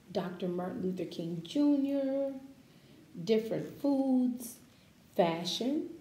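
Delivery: usual speaking voice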